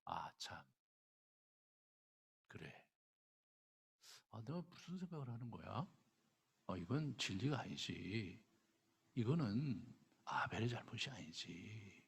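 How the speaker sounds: quiet inner voice of regret; Cain coming to his senses. Retold or imitated. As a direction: murmur it as you realise your mistake. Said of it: "이렇게 말씀하실 때".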